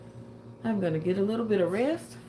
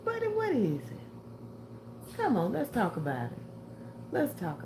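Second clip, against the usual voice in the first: pet talk; 3 semitones higher, 6 dB quieter than usual. Real, not acted. Coaxing the dog.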